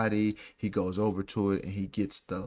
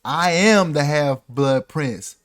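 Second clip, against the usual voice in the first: grand, slow, loud declaration; Snape; 4 semitones higher, 9 dB louder, slower than usual. Retold or imitated. Snape confronting Harry over his spell, haughty and booming. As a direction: announce yourself with haughty, drawn-out weight. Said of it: "and Snape was like".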